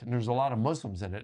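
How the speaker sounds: ordinary delivery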